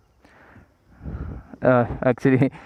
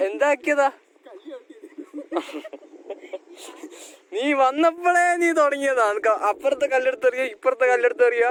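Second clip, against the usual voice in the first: scolding; 12 semitones higher, 4 dB quieter than usual. Real, not acted. Scolding friend after stone fall.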